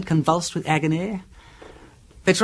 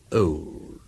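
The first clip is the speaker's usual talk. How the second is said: soft whisper on the final word; whispered ending; 9 semitones lower, 4 dB quieter than usual. Acted. Peter closes the poison story to Charles in a whisper. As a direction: let the tale's end fall to a whisper.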